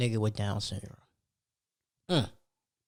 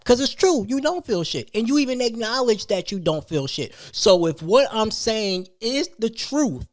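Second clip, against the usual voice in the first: heated rant; 7 semitones higher, 4 dB louder than usual. Real, not acted. Heated rant about feeling.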